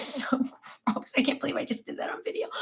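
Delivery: laughing, whispered; sheepish hushed laugh; embarrassed whisper. Real, not acted. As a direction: hide the embarrassment in a giggly whisper. Embarrassed after snorting on video.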